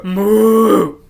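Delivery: long, loud mock moo; booming cow impression cutting in; interrupting cow. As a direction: burst in with a big drawn-out moo.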